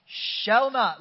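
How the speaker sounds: rising preacher's declaration; building proclamation. Real, not acted.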